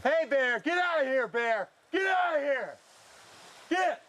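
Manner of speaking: high yell, get out of here; scaring off a bear. Real, not acted. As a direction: shout the bear off the beach. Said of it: "Let's yell at him again".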